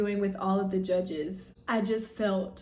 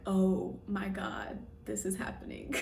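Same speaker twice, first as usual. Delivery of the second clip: awed whisper; 2 semitones lower, 7 dB quieter than usual. Retold or imitated. Called into top five.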